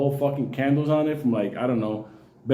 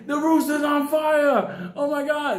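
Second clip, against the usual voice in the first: panicked scream; 9 semitones higher, slower than usual. Retold or imitated. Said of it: "the people are screaming".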